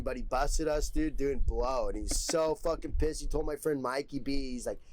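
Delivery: ordinary delivery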